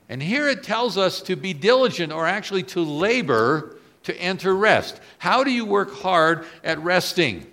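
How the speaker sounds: loud, high impassioned preaching; preaching at full force. Acted. Preacher stirring the congregation, loud and high. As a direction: drive the question home from the pulpit.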